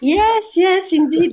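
high, loud, delighted agreement; excited yes. Real, not acted. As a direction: burst out a gleeful yes. Playful English reply to a joke.

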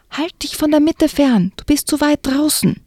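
calling out. Acted. Will calls warning across ice.

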